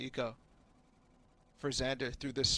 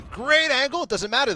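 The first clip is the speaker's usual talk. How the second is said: excited caster; 10 semitones higher, 10 dB louder than usual. Acted. Co-caster hype.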